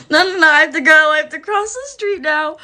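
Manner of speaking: high, loud, hurried-away protest; panicked refusal. Acted. Storyteller refusing a woman grabbing her arm, high panicked refusal. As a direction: squeal it as a mock-panicked escape.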